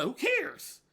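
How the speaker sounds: high, scornful who cares; scornful dismissal, high. Acted. Heated reply to imagined objector.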